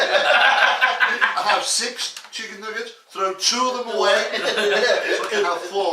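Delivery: slow, laughing joke; drawn-out giggly punchline. Pretend. Silly nugget joke, laughing.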